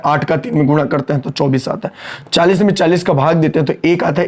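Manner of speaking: usual speaking voice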